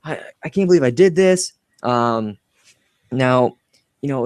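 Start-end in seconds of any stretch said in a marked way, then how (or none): 0.0-1.5 higher, panicky imitation; higher, mock panic; mock freaking out. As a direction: fret as if you've ruined everything. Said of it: "they're freaking out".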